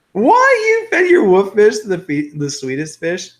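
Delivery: loud, high incredulous question; high-pitched outburst of disbelief; shocked disbelief. Real, not acted. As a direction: burst out in astonishment. Mock shock at viewer's message.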